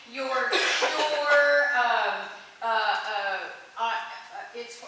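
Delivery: ordinary talk, nothing marked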